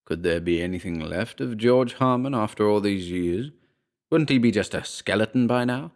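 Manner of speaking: usual speaking voice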